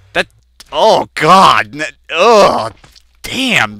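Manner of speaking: loud, jolted cries of alarm; real startled fright. Real, not acted. Gamer caught by a sudden scare mid-story, startled. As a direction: blurt it out, badly startled. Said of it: "thoroughly scared the crap out of me".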